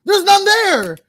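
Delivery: very high, loud, drawn-out yell; slow falsetto shout; shrieked retort. Real, not acted. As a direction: holler it in squeaky indignation. Mock indignation in eyebrow banter.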